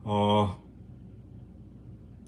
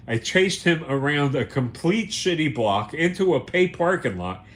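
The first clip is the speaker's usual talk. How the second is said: ordinary delivery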